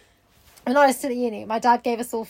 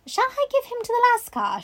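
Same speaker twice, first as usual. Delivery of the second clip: high-pitched, lightly put-on girl's query; polite child's question; 11 semitones higher than usual. A narrator's reading. Sarah offers to return the monkey to its owner, high polite question. Voicing Sarah's line in the story.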